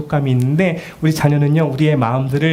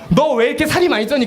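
higher, mock-reproachful mom; scolding mother; 10 semitones higher than usual. Mother reproaching child about weight, mock. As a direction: tut at the chubby child.

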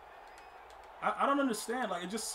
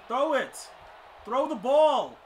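loud, raised, urgent demand; impatient calling out; 4 semitones higher, 8 dB louder, much slower than usual. Real, not acted. Gamer urging a throw, impatient and loud. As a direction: yell the order at the screen. Calls out at the game.

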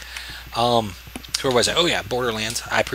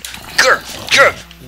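1 mock growl; 11 semitones higher, 9 dB louder than usual. Fighting mob with sword.

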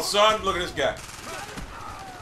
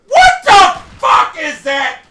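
high, loud, drawn-out swearing; outraged shout; 5 semitones higher, 8 dB louder, slower than usual. Real, not acted. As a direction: yell slowly in disbelief. Outraged at the game.

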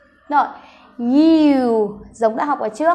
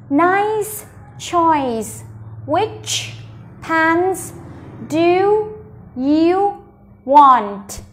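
slow teaching model, a pause after each word; 2 semitones higher, much slower than usual. Shown as a demonstration. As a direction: stretch each word and separate it clearly. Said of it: "đọc nhỏ từng từ một".

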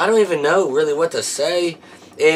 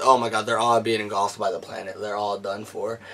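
usual speaking voice

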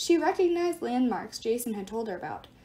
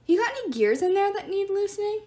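high, playful teasing; 10 semitones higher, 5 dB louder than usual. Acted. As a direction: tease him like a mechanic. Leo teasing.

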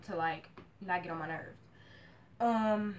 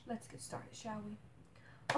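eerie whisper; 10 dB quieter, much slower than usual. Pretend. Spooky whispered intro.

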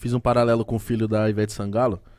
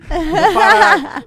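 high squeaky laughing words; 10 dB louder, much slower than usual. Real, not acted. Telling the story and breaking into laughter. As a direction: crack up mid-sentence.

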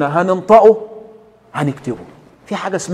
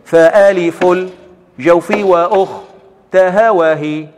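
restarted verse recitation; slower than usual. Shown as a demonstration. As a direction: start the verse over clearly and firmly. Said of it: "كمل، هنبدأ تاني".

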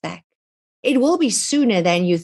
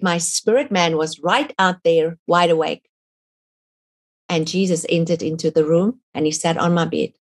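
ordinary talk, nothing marked

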